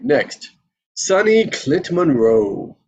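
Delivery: ordinary delivery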